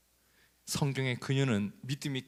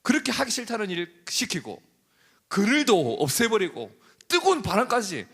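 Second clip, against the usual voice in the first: much higher, louder furious cry; Jonah's angry outburst; 10 semitones higher, 5 dB louder than usual. Acted. Jonah shouting at God in fury, high and loud. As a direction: rage at God, begging to die. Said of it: "이렇게 하죠".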